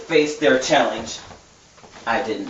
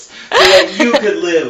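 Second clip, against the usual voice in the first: laughing retry; 7 semitones higher, 9 dB louder than usual. Real, not acted.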